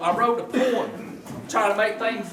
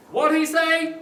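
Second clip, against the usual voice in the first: higher, louder mock deaf wife; hard-of-hearing wife asks husband, louder higher mock question; 9 semitones higher, 4 dB louder, slower than usual.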